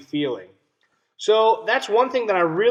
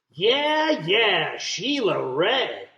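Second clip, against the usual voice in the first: high, eager cheer drawn out slow; cheering friends; 4 semitones higher, much slower than usual. Acted. Friends cheering Sheila Ray, mock excited yell. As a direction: cheer her on like a fan. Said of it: "her friends yelled, clapping their hands".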